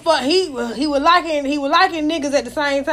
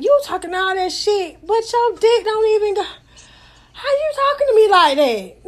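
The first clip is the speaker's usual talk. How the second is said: higher sassy comeback; 7 semitones higher than usual. Retold or imitated. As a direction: throw it back in his face. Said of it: "and I'm like".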